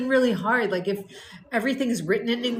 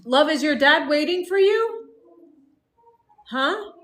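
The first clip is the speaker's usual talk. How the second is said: louder, higher call across the house; 9 semitones higher, 3 dB louder than usual. Real, not acted. Mother calling to her husband about taking the boy. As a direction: call out to someone in another room.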